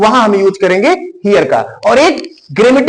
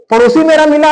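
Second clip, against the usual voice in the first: ordinary delivery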